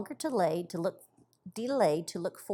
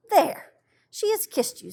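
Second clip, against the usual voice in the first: much higher, louder delighted announcement; high delighted child; 10 semitones higher, 6 dB louder than usual. Acted. Violet telling Peony the snow girl kissed her, high and thrilled.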